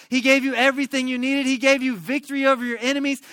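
loud, high, insistent list; acted prophet's forceful scolding; impassioned rebuke. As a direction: pile up each reproach with rising force. Nathan rebukes David, listing God's gifts.

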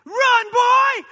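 high, loud shout across the track; coach shouting. Retold or imitated. Track coach yelling to his runner, loud high shout. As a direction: yell it like a coach urging a tired runner. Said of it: "hearing this voice shouting".

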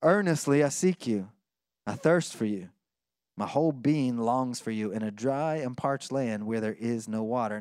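quietly praying the psalm; hushed, longing plea to God; soft, low-pitched prayer read with longing. Retold or imitated. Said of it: "he says".

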